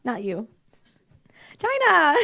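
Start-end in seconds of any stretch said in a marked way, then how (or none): 1.6-2.2 excited call. Real, not acted. Announcing a birthday girl.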